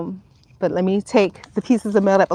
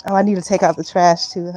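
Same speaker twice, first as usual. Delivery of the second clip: usual speaking voice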